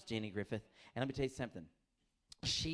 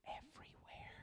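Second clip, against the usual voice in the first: ominous whisper; 16 dB quieter than usual. Retold or imitated. Ominous friend reply.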